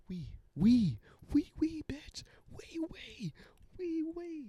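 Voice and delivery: very high whispered squeak; mimicking a funny video in a tiny squeaky voice; squeaky mimicry.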